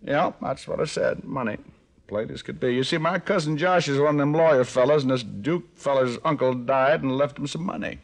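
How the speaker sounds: put-on Arkansas country accent. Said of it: "How about a Swedish dialect?".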